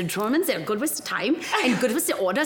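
hammed-up German accent; mock German accent. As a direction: imitate a German with a lilting, caricatured accent. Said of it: "he doesn't talk like that".

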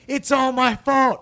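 put-on high, loud, slow kid wail; hammed-up sobbing confession; mock wailing. Little boy wailing to his dad, mock sobbing. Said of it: "I'm just like".

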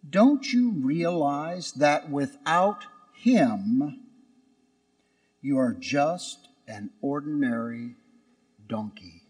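a mother gently setting her son straight; gentle. Acted. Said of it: "she said gently".

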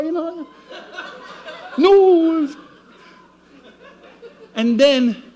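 normal talking voice

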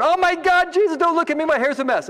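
high-pitched, flustered mock dismay; mock panic. Preacher playing someone waking beside Jesus, high mock panic. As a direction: blurt it out high, hammed-up embarrassment.

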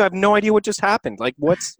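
ordinary talk, nothing marked

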